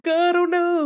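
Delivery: put-on high, strained kid voice; little Anya voice. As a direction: blurt it like a flustered child. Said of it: "And she's like".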